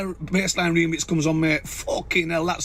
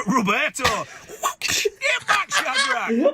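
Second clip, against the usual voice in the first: higher, cry-shout; yelled punchline; 9 semitones higher, much slower than usual. Acted. Joker repeating his punchline with a howl. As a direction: bellow it even wilder.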